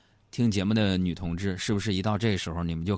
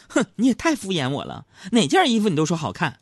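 mock pouty grumble, pitched up; pouting complaint; 9 semitones higher, 4 dB louder than usual. Wife feels brushed off by husband's praise, pouting complaint. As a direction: imitate a wife complaining with a pout. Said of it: "嘟着嘴抱怨说".

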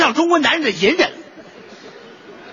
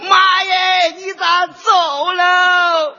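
high, slow hammed-up wailing cry; mock funeral wail; 8 semitones higher, much slower than usual. Child wailing at mother's funeral, hammed-up sobbing shout. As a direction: overplay the grieving child howling for mom.

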